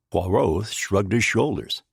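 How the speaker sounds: ordinary delivery, nothing marked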